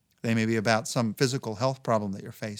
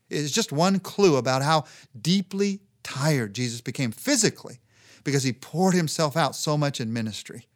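ordinary talk

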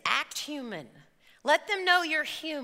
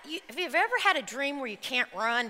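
ordinary talk

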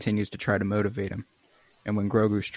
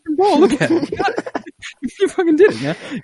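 much higher, louder, laughing through it; laughing cheer; 9 dB louder than usual. Retold or imitated. Din celebrating the kid's catch: high laughing cheer. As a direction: whoop with delighted laughter.

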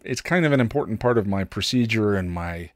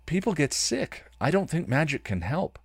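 lightly higher, gentle refusal; Riley's voice; 7 semitones higher than usual. Acted. Riley to Buffy, gently and a touch higher. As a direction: let her down gently.

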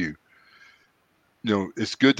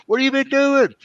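pitched-up mimic of the older friend calling out; Seth's voice. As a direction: put his eager friendly voice on, pitched up high. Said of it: "He goes".

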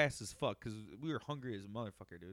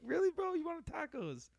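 much higher, incredulous "really, bro"; surprised high disbelief; 13 semitones higher than usual. Retold or imitated. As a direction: sound stunned and mocking. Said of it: "I'm like".

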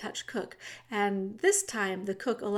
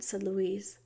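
ordinary delivery, nothing marked

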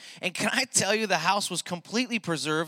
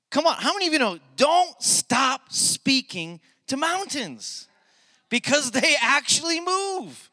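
loud, high, soaring preacher's cry, stretched slow; full-force preaching; 8 semitones higher, 8 dB louder than usual. Acted. Pastor crying out to the congregation at the climax, loud and soaring. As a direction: lift the room with a triumphant shout.

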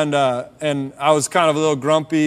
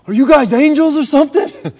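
amazed disbelief; 9 semitones higher, 6 dB louder than usual. Retold or imitated. Hitchhiker amazed by golden cross.

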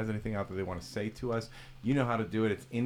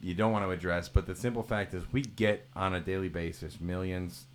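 ordinary delivery

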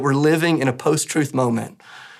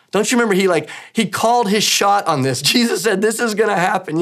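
excited; 6 semitones higher than usual. Real, not acted. Excited retelling of Jesus' prediction.

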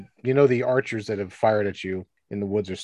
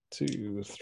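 ordinary talk, nothing marked

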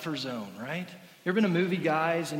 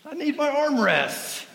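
mock indignation; 11 semitones higher than usual.

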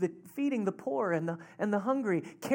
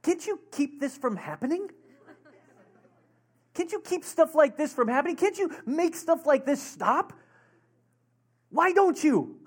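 frustrated plea; 7 semitones higher than usual. Retold or imitated. Church planter questioning God after the mess, building frustrated plea. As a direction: plead in mock exasperation, ending in an outburst. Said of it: "it was so frustrating".

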